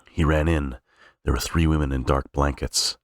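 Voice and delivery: usual speaking voice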